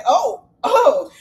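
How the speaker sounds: high, loud exclamations with a laugh; amused, scandalized "oh, oh"; reacting with "oh". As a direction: pitch leaps up in mock shock. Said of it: "but, ooh, I say".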